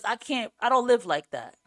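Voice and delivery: usual speaking voice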